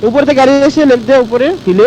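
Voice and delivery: urgent callout. Real, not acted. Urgent callout of enemy car.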